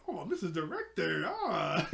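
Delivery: hammed-up cheery voice rising high at the end; happy-go-lucky. [name] to Mister Director, mock happy-go-lucky. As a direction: imitate someone being breezily nice. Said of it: "trying to be all happy-go-lucky".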